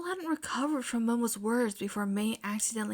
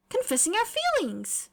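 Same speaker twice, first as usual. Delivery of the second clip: proud chirp; 10 semitones higher than usual. A narrator's reading. May answering proudly.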